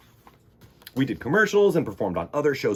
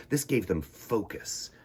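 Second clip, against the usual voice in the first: ordinary delivery, nothing marked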